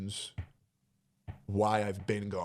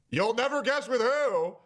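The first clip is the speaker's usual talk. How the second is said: teasing reveal; 11 semitones higher, 4 dB louder than usual. Real, not acted.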